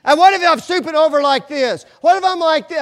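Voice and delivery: high, loud mock wife voice; imitating his wife. Husband acting out his wife posing in the dressing room, high and loud. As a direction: ham up the wife posing and asking. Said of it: "And she would go".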